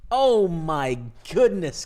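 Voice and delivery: slow loud drawn-out oh my goodness; stunned. Real, not acted. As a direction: drag it out in disbelief. Stunned at river card.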